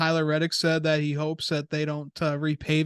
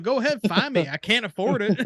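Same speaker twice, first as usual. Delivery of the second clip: mock yell, pitched up, on the edge of laughing; retold yelling; 5 semitones higher than usual. Driver yelling at the officials, mock defiant yell. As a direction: imitate him hollering at officials, amused. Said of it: "he was yelling at the officials".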